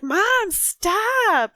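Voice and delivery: put-on high-pitched, drawn-out child's protest; high, stretched mock wail; mock kid's scream. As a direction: imitate an exasperated kid yelling without full volume. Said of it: "my kid will just scream at me".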